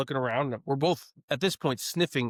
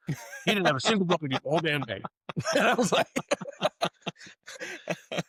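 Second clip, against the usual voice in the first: laughing; 4 semitones higher, faster than usual. Real, not acted. Laughing while retelling.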